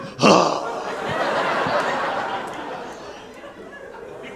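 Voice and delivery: sharp, high, breathy gasp of horror; host gasping in mock horror; shocked gasp. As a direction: gasp like a sacrilege just happened. Host shocked at flushed toilet.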